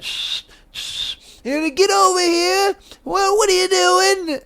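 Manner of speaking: feeble high-pitched British policeman; shush, then scold in a silly high voice; mock UK cop. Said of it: "The U K cops are fucking sad".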